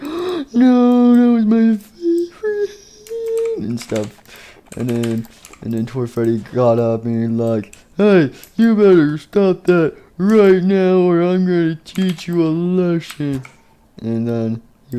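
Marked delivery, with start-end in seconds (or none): 0.0-3.6 very high, squealed lament; mock wail. Toy Bonnie laments bitten hand. 8.0-13.4 high, slow, drawn-out warning; threatening character. Acted. Toy Freddy threatens the attacker.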